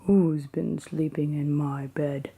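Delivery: a hint of a grumpy father bear, still whispered; lightly voiced Papa Bear, gruff but hushed; big grumpy Papa Bear. Papa Bear grumbling about his porridge, a mild whispered growl. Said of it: "cried Papa Bear in his big grumpy voice".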